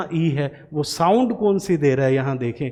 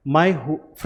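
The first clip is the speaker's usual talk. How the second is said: usual speaking voice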